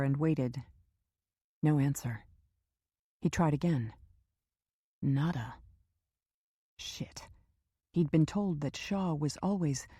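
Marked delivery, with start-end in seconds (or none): 5.0-7.3 muttered under the breath, unvoiced; whispered curse. A narrator's reading. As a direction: swear silently to yourself. Door unanswered, private curse.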